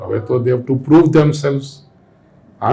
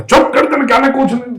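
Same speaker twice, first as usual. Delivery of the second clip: much higher, shrieking scold; furious mother yelling; 12 semitones higher, 4 dB louder than usual. Retold or imitated. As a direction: slap and shout at the stubborn child. Mother slaps and yells at child. (ગુસ્સે થયેલી માની બૂમ)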